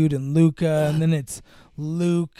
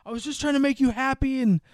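mock pleading; 7 semitones higher than usual. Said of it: "he's like".

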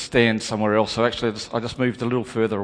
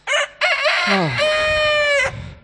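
long high wailing groan; anguished cry; 5 dB louder than usual. Acted. Peter crying out in anguish at his betrayal. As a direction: let the grief break out as a cry. Remorse after the denials.

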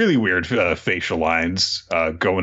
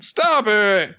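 mock teacher; 12 semitones higher than usual. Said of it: "the teacher's like".

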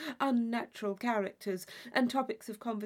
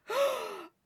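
very high gasp of delight; sharp high breath in; thrilled gasp; 13 semitones higher than usual. Acted. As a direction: gasp as if at a ghost story. Catherine gasps at her guess.

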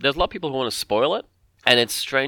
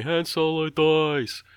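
drawn-out, higher-pitched imitated call; half-voiced yell, stretched out; mock yelling out; 6 semitones higher, much slower than usual. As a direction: call it out slowly like a heckler outside a cinema. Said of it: "just yelling out the spoilers".

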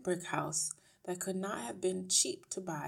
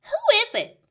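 very high, loud put-on woman's voice; brash wife; 18 dB louder than usual. A narrator's reading. Wife calls without looking from TV, brash. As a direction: call out loudly in a high voice.

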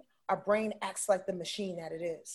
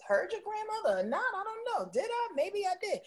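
much higher, dithering put-on voice; mock doubter; 12 semitones higher than usual. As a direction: play a flustered second-guesser. Mocking a doubting beginner.

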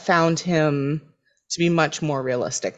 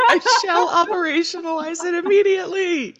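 high, posh-voiced laughing pledge; hammed-up earnest business guy; mock formal; 14 semitones higher than usual. Playing Noel as co-founder.

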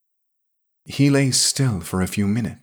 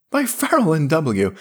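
half-hysterical laugh; 9 semitones higher than usual. A narrator's reading. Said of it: "choking into his mug".

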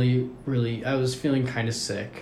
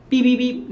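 high, loud electronic beeps; thermometer beeps; 13 semitones higher, 7 dB louder than usual. Retold or imitated. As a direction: imitate a beeping thermometer loudly, high up.

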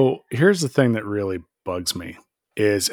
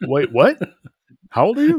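mock alarm; 6 semitones higher than usual. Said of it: "was like".

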